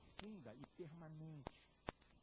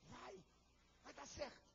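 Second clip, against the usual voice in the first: voice of emotion; 11 semitones higher, slower than usual. Acted. Inner emotion urging him on, rising eager push. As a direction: tempt yourself, coaxing then urging. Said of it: "a minha emoção dizendo".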